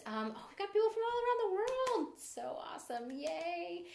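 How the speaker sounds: thrilled to see everyone; excited. Real, not acted. Viewers from everywhere joining.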